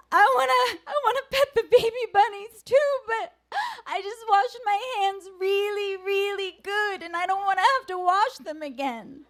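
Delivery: put-on high, fast, tearful little boy's plea; upset four-year-old. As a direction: whimper it like a small child near tears. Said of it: "he was like".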